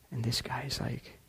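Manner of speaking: ordinary talk, nothing marked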